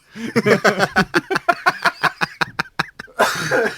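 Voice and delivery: high cackling laugh; loud laugh. Real, not acted. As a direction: burst out laughing. Big laugh after cherub line.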